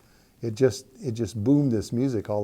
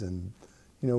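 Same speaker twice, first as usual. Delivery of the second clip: ordinary talk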